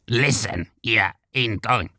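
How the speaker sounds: rasping goblin voice; throaty goblin. A narrator's reading. A goblin snarls at the elf, throaty. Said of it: "throaty voice".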